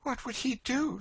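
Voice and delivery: very high, anxious question; breathless interest. A narrator's reading. Girl asks what the master would do, breathlessly intent. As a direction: ask it high, hanging on the answer. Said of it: "looking with breathless interest".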